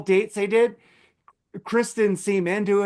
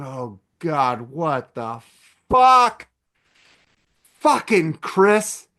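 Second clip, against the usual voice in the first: outraged yell; 2 semitones lower, 4 dB louder, much slower than usual. Retold or imitated. Gorman's outraged reaction retold.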